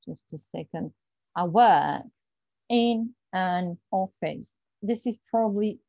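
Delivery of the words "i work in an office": "I work in an office" said slowly, every word apart. Shown as a demonstration. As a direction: spell it out like a foreigner reading. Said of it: "what you would say".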